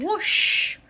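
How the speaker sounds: ordinary delivery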